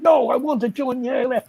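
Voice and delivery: mock-booming indiscreet declaration; loud voice. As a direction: imitate someone announcing it to the whole room. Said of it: "he said in a very l-, loud voice".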